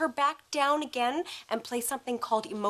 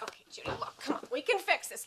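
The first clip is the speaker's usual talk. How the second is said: ordinary talk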